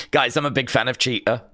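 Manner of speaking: normal talking voice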